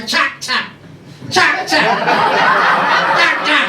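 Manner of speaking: put-on high, excited toddler shouting; mock toddler. Comic imitating a small child naming a tractor, high excited cry. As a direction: shout it like a thrilled three-year-old. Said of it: "like when we were three and go".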